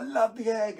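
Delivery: put-on high, eager little girl voice, lightly done; dad playfully imitating his young daughter's excitement; excited child imitation. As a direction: squeal it like an excited kid. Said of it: "She's like".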